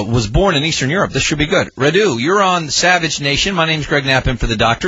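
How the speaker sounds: ordinary delivery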